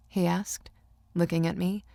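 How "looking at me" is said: plain reading voice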